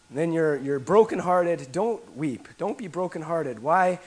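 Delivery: ordinary talk, nothing marked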